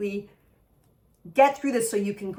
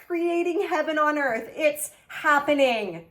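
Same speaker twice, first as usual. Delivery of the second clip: excited reread; 6 semitones higher than usual. Real, not acted. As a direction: repeat it thrilled and lifted.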